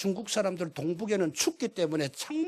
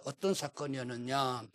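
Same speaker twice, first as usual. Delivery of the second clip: usual speaking voice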